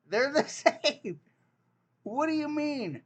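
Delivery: high, loud laughing protest; laughing disbelief. Real, not acted. Insisting three words sound the same, laughing and high.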